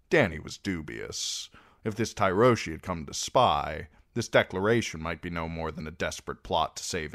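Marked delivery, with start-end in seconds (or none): none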